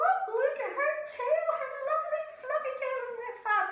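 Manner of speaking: high, fast gushing chorus; high cooing admiration; admiring animals. Acted. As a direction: coo over the tail in a high voice. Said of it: "all the other animals would go".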